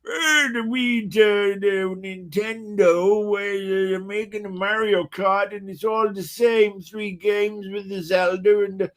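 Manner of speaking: put-on high, slow, creaky old geezer grumbling; cranky old gamer griping about Nintendo games; grumpy old man. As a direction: play a cranky seventy-year-old ranting about Nintendo. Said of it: "when I'm seventy going".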